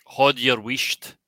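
"Hod your wisht" spoken slowly in Scots; Scots phrase demo. Shown as a demonstration. Posing a Scottish phrase to the guessers, slow Scots demo. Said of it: "if you were saying this to someone".

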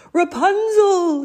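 high, loud, drawn-out call. A narrator's reading. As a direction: call for a lost love. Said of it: "he called out".

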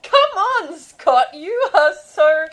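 high, exasperated mock mom; mom protesting. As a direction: whine it back at the son. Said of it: "And it's like".

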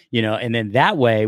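usual speaking voice

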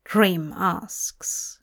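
ordinary delivery

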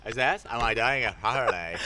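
badly imitated Cockney with Valley Girl flavour. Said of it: "like Valley Girl Cockney".

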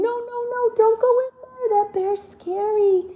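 high, squeaky mock warning; scared little animals. Hidden forest animals warning the colt, high and fearful. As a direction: hiss a frightened warning from hiding. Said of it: "all these animals are hiding behind the tree saying".

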